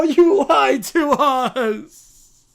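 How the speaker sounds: very slow, loud sobbing drawl; bratty child. Acted. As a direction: stretch it out in a wailing sob. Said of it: "the Brat Pack".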